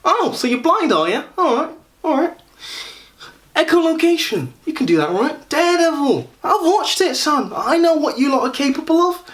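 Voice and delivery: high-pitched, cocky Essex know-it-all caricature; Gary. Retold or imitated. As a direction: put on a squeaky Essex lad voice. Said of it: "one or two voices of Gary".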